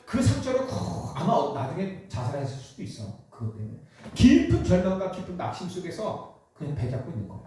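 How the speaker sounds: usual speaking voice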